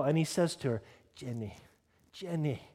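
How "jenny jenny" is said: put-on soft, light Scottish accent; gently calling her name in mild Scottish; attempted Scottish accent. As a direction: have a modest go at a Scottish accent. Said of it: "That's my best Scottish accent".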